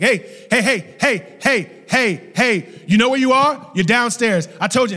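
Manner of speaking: put-on high, loud shouts of a dad rousing his kid; insistent, barked parental wake-up; yelling father. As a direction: imitate a dad yelling someone awake. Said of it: "he's still yelling".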